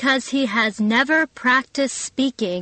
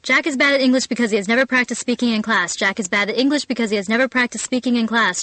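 fast drill; much faster than usual. Shown as a demonstration.

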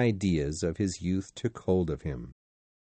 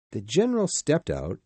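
usual speaking voice

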